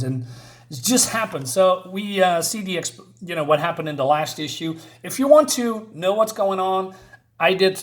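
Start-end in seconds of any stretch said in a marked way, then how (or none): none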